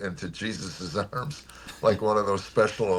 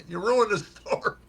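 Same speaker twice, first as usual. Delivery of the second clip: much higher, hammed-up indignant complaint; mock outrage; 14 semitones higher, 3 dB louder than usual. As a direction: play-act being betrayed by an old friend. Joking outrage at his friend.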